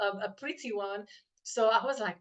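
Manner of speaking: usual speaking voice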